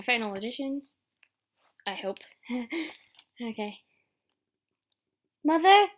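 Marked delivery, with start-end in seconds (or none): 5.4-5.9 loud, higher call for mother. Acted. A child calling out for his mother, loud. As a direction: call out across the distance.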